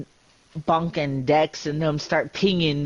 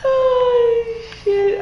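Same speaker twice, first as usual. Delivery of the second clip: high, loud startled cry; surprised outburst; 5 dB louder than usual. Real, not acted. Reacting to the replay moment, loud startled cry. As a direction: blurt it out in shock.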